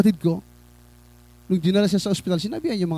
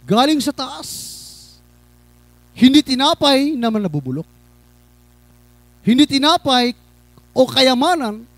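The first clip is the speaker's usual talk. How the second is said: raised, projected preacher's voice in short bursts; emphatic preaching; 7 semitones higher, 8 dB louder than usual. Real, not acted. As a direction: preach it out firmly, phrase by phrase. Preaches to congregation. (madiing pangangaral)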